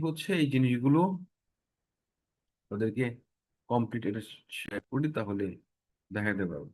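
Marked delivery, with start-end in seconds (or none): none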